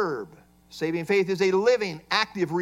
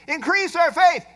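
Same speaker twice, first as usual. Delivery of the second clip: mock plea; 7 semitones higher, 4 dB louder than usual. Mimicking the apostles' plea.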